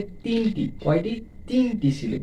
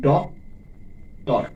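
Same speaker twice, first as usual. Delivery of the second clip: "Doctor" split as ডক, long pause, টর; syllable by syllable; 3 semitones lower, much slower than usual. Shown as a demonstration. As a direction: slowly separate doctor into two beats. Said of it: "দুইটি syllable আছে".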